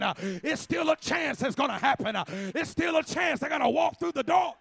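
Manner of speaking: loud rhythmic preaching with gasped uh; full-force preaching. Acted. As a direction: whoop it up like a revival preacher. Preaching to the congregation.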